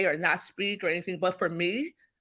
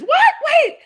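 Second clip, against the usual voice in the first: high, loud and laughing, stunned she was hung up on; laughing disbelief; shocked disbelief; 8 dB louder than usual. Retold or imitated. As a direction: squeal of disbelief, much higher and louder. Retells shock at hang-up.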